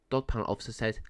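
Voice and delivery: usual speaking voice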